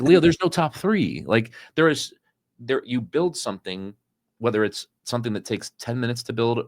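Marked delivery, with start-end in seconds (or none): 0.0-1.2 higher and slow, spelling it out; patient but fed-up correction; exasperated insistence. Retold or imitated. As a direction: lay it down firmly, word by word. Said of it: "I'm like".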